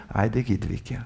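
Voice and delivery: put-on low, soft, bored whine of sleepy children; tired kids. Preacher voicing kids refusing the game, bored whine. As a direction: drag it out like a child who won't play. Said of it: "Som trøtte unger like før leggetid".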